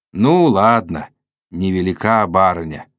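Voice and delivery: ordinary delivery, nothing marked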